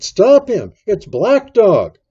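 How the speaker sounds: high, urgent shout; very loud call of alarm; alarmed cry. A narrator's reading. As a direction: yell to the whole room to stop him. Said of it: "I cried".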